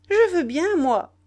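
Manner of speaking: high, loud, offhand consent; brash retort. A narrator's reading. Nana answers the pleading boy, loud and brash. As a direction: toss the agreement back as a challenge.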